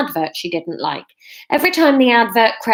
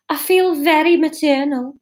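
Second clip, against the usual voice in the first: higher put-on regional accent; attempted Brummie; 7 semitones higher than usual. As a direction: read Catherine in an attempted Birmingham accent. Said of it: "I'm just gonna try and read this".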